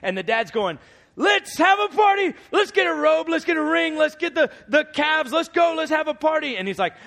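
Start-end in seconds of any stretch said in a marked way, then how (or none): 1.2-6.6 much higher, loud ecstatic party call; father ordering a celebration, shouting happily; excited shouting. Retold or imitated. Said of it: "he starts screaming".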